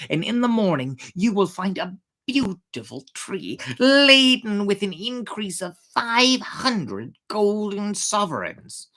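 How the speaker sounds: ordinary delivery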